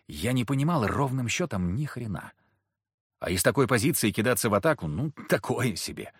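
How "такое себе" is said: ordinary delivery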